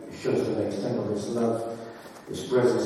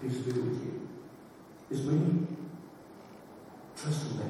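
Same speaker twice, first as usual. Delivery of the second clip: soft, much quieter and tender; gently; 11 dB quieter than usual. Acted. Jesus calming his frightened disciples, soft and gentle. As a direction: speak peace softly to frightened friends. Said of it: "he comes in very gently and he says".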